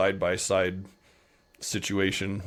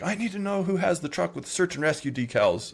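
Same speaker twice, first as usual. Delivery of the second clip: high-pitched public demand; much higher, insistent announcing voice; calling out; 9 semitones higher than usual. Retold or imitated. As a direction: imitate someone calling across a room. Acts out choir member calling out.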